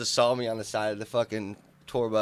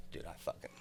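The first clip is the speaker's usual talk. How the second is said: whispered; 5 semitones lower, 14 dB quieter than usual. Retold or imitated. Rapper's friend hushed.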